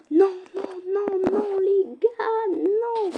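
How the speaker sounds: cris très aigus, plus forts; protestation paniquée. Real, not acted. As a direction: refuse la défaite dans l'aigu. Défaite soudaine en jeu. (vrai cri de désespoir de joueuse)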